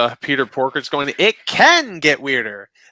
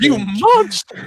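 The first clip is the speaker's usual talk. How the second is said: mock outrage; 9 semitones higher, 4 dB louder, much slower than usual.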